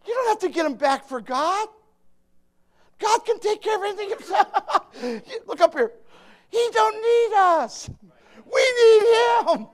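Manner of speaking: loud, high, shouting preacher; fiery preaching. Acted. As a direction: preach it hard to the church. Preaching at full force.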